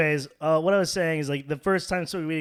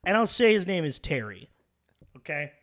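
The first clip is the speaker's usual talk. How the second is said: usual speaking voice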